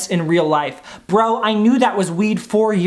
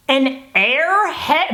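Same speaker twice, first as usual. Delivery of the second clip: slow, stretched high squeak; higher, drawn-out testing of the voice; hunting for the squeak; 6 semitones higher, much slower than usual. Shown as a demonstration. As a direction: draw the word out until the voice cracks high. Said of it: "There it is".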